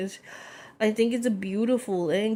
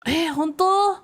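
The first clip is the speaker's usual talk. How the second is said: surprised; 7 semitones higher than usual. Retold or imitated. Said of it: "was like".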